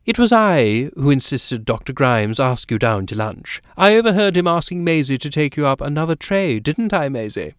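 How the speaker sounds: a brisk, talkative matron; eager society lady. Acted. Lady voiced in story.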